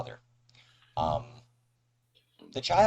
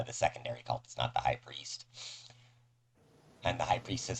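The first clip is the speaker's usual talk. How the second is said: ordinary delivery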